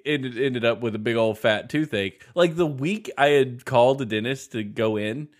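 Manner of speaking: normal talking voice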